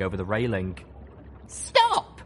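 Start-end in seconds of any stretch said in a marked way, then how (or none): 1.5-2.2 sharp high order to stop; commanding shout. A narrator's reading. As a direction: bark the order, loud and high. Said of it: "I ordered".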